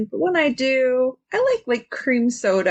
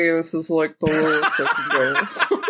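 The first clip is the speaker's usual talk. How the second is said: pushes her voice as low as it goes, laughing; lowest voice; 6 semitones lower than usual. Shown as a demonstration. Cohost asked to go low, deep voice with a laugh. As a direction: dig down to the bottom of her range. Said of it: "take your voice low with me".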